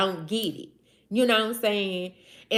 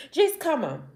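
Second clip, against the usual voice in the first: usual speaking voice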